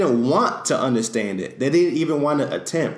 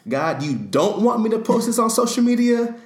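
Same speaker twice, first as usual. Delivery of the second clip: mock disbelief; 12 semitones higher, 4 dB louder than usual. Voicing a believer asking God.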